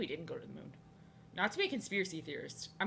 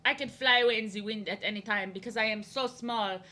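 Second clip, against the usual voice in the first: hammed-up French accent, haughty boasting; mocking French girl; 4 dB louder than usual. French girl putting down American kids in drawing class, mock French accent. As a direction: imitate a mean French classmate with a thick French accent. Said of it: "that's how she acted".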